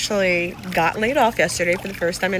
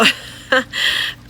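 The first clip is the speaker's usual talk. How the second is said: nervous laugh; 8 semitones higher than usual. Real, not acted. Nervous laugh after layoff.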